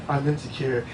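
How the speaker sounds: soft low mumble; small insecure voice. Pretend. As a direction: shrink into it. Voicing his insecure side.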